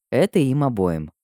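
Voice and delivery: plain reading voice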